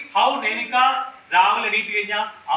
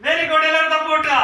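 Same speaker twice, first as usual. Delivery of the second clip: higher and louder, cheering the class on; excited praise; 6 semitones higher, 4 dB louder than usual. Real, not acted. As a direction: shout out the praise and call for applause. Teacher praises, asks class to clap.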